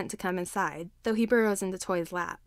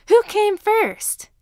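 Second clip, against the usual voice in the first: put-on high, slow, drawn-out kid's taunt; cheeky child voice; 12 semitones higher, 9 dB louder, much slower than usual. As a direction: tease the teacher in a much higher child's voice. Cheeky kid's quip at teacher.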